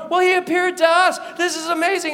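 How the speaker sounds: loud, high exclamation; excited one-upping. Acted. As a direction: burst out with your own amazing news. Disciples trade news excitedly.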